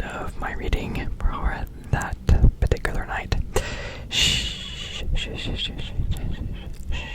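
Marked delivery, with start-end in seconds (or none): 4.1-7.0 gentle drawn-out shh sounds; soft shushing. Shown as a demonstration. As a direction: shush softly into the mic. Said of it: "shushing trigger sounds".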